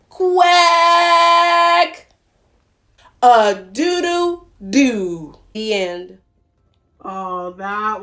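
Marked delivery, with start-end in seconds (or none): 0.1-2.1 loud long quack; mighty roared quack. Acted. Dodo roaring a mighty quack for everyone. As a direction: roar the quack. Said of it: "roared a mighty".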